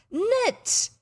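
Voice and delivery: put-on boy outraged at being told to knit; scornful outrage. A narrator's reading. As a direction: spit the word in disgust. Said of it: "His scorn and fury were indescribable".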